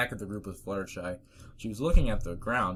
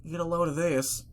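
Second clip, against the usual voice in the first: flat tone; 8 semitones higher, 3 dB louder than usual. Acted. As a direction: say it flat and uneasy. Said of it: "unusually flat tone".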